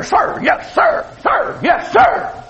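high clipped sir yes sir; barked military recruit reply. Retold or imitated. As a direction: snap it out like a recruit. Recruits answering in boot camp.

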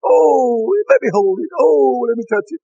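high-pitched, cooing church ladies; hammed-up adoring baby admirers; gushing over baby. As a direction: coo and fuss over someone's baby. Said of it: "they say".

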